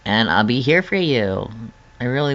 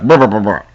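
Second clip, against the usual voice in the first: mock shiver noise; 3 semitones lower than usual. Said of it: "I'm like".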